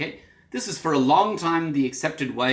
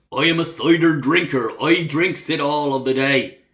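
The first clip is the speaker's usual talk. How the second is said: broad West Country Wurzel accent; rolling rustic Cornish with heavy R's; 5 dB louder than usual. Shown as a demonstration. Teacher demonstrating the Wurzel sound, broad Cornish accent. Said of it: "They'll have the Wurzel".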